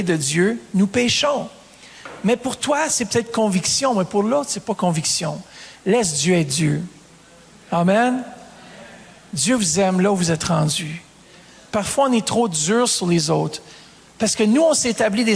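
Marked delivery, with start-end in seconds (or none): none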